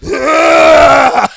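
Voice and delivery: loud, high-pitched yell; mock scream. Storyteller acting out the imagined moment on his chest, mock scream. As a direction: scream out in comic horror.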